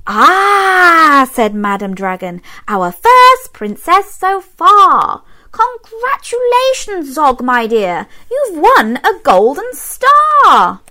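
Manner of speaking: beam with pride; Madam Dragon praising. A narrator's reading. Dragon teacher rewarding Zog, high and proud.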